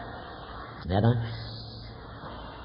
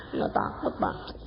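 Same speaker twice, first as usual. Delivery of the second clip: whispered, frightened reply; a pregnant woman whispering her fear; timid whisper; 9 dB quieter, slower than usual. Retold or imitated. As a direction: breathe it out like a scared mother. Frightened woman answers preacher.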